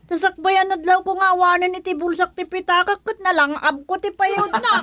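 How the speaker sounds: put-on shrill old woman crying out that she is being blamed; agitated old woman. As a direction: cry out like an outraged old woman. Old woman tells captain about theft.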